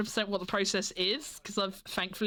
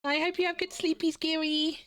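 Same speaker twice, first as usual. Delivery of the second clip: high sweet goodnight; 6 semitones higher than usual. Real, not acted. Cooing goodnight.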